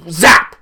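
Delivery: loud, sudden zap; zap sound effect. Retold or imitated. Narrator imitating the lightning hitting the ship, explosive zap. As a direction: fire it off like a lightning bolt.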